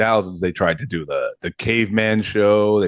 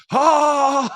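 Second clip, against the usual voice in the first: mock shriek. Shown as a demonstration. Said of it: "it would have been pretty great if you just, like".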